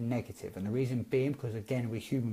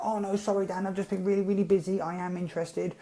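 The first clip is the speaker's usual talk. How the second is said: flustered apology; 6 semitones higher than usual. Retold or imitated. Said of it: "have come back to me and like".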